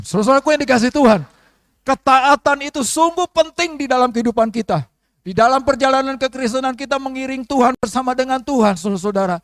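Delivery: loud, fast, driving sermon delivery; full-force preaching. Acted. Pastor exhorting the church in full loud preaching voice. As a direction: preach it out to the congregation.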